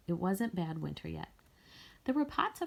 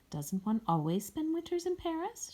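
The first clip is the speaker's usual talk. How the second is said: airy, higher society-lady tone; mock fashionable lady; 10 semitones higher than usual. A narrator's reading. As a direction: toss it off like a woman of fashion. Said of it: "as if she were a woman of fashion".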